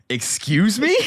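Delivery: high, loud, incredulous "Excuse me?"; stunned excuse me. Retold or imitated. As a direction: yelp it out in disbelief. Shocked reaction to grandma.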